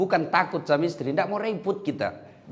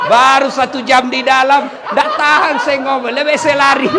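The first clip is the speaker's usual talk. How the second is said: much higher, loud shouting complaint; fleeing jinn; 8 semitones higher, 10 dB louder than usual. Retold or imitated. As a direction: escape in a panic, yelling. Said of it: "Dia bilang".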